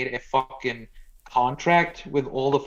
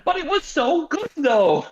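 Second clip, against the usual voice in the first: much higher, whiny protest; mock leftist whine; 14 semitones higher, 3 dB louder than usual. Mocking leftists who loved the book, high whine. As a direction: squeal it like a fan defending a favourite book. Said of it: "the fucking leftists turn around".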